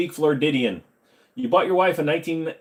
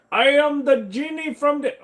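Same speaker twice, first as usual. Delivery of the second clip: put-on high, slow Indian accent genie; 12 semitones higher than usual. Joking for chat, slow high Indian accent genie. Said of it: "okay, that's racist".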